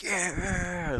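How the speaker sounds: high cheering yell; a mob member whooping; crowd shout. Acted. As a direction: roar agreement with the mob. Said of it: "like, shouting".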